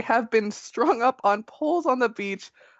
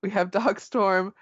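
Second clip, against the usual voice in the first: usual speaking voice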